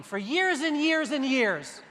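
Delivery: usual speaking voice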